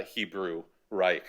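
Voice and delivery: ordinary talk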